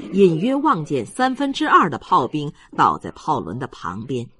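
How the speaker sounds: ordinary delivery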